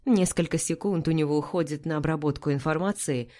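ordinary delivery, nothing marked

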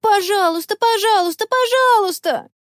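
high, climbing string of pleas; begging cries. A narrator's reading. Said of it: "закричали хором все четверо". (настойчивая мольба высоким голосом)

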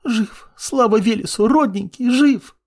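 high, sobbing relief; tearful joy. A narrator's reading. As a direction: cry out with overjoyed relief. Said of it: "заливаясь слезами".

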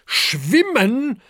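high, loud disbelieving question; incredulous cry. Acted. Parents Adele and Herbert disbelieving together, loud. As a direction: shout the word in disbelief. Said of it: "rufen Adele und Herbert wie aus einem Maul".

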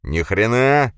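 loud, rising growled refusal; angry growl. A narrator's reading. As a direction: roar a refusal. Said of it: "зарычал бык".

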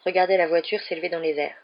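plain reading voice

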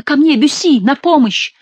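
high, frantic call for help; crying out. A narrator's reading. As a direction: cry out high as if begging rescue. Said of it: "кричала Диана".

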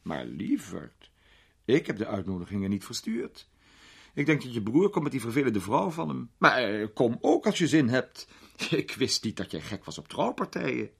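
a hint of a grand old lady, amused; an elderly duchess teasing a young man; lightly voiced duchess, amused.

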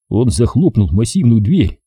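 ordinary delivery, nothing marked

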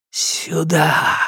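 darkness hissing a call. Acted. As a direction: hiss it like a beckoning shadow. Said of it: "прошипела тьма".

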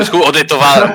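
laughing. Real, not acted. Laughing at own slip.

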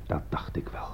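grim low whisper. Acted. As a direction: breathe it out knowingly. Grim hushed reaction. (zacht gefluisterd)

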